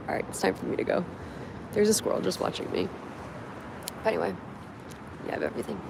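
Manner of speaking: hushed whisper while hiding. Real, not acted. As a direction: whisper so nobody hears.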